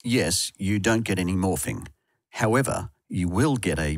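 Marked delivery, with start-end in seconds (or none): none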